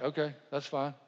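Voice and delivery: soft, lightly voiced nonchalant wife; mild imitation of an unfazed reply; unbothered. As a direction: shrug it off quietly. Wife's unbothered reply, retold.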